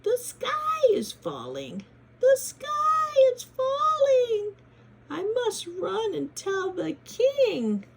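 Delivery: high, loud and slow hen squawk; high, loud squawking warning, stretched out; panicked hen. Acted. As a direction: cry out the alarm like a frantic hen. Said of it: "she squawked".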